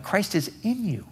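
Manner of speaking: in pitched high and leaned on; stress on in. Shown as a demonstration. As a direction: stress in, not near, not with. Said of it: "this is the ultimate".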